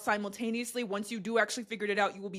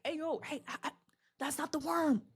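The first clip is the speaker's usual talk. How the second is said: cowering, hesitant warning, higher and softer; SpongeBob; 6 semitones higher, 5 dB quieter than usual. Retold or imitated. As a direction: stammer it timidly from a hiding place. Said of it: "SpongeBob is behind the rock cowering".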